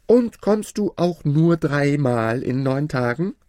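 loud, high-pitched questioning; calling out. A narrator's reading. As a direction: call the question up to a giant. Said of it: "griff nach der Lampe und rief". (laut und hoch fragend)

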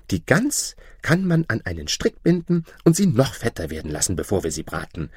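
high-pitched happy housewife; admiring, delighted wife. Acted. Wife praises her husband for the sheep, delighted. Wife rejoices over goose.

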